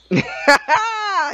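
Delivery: high burst of real laughter. Real, not acted. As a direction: crack up, way up high. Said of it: "I just bust her laughing".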